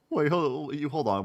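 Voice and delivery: normal talking voice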